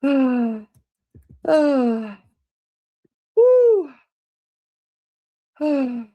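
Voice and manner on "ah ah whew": breathless gasps rising to a cry; dizzy gasping. Real, not acted. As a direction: gasp through a dizzy spell. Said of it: "I'm so dizzy".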